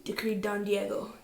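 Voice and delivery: plain reading voice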